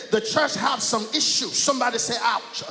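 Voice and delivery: loud, rising sermon cadence, calling out to the church; whooping gospel preacher building to a peak; full-force preaching. Acted. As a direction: preach it up with the music behind you.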